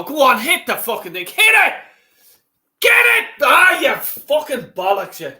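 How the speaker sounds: urging the shot in a rising yell that explodes into a furious curse; frantic shout at the telly. Real, not acted. Fan roaring at the striker to shoot, real frustrated shout.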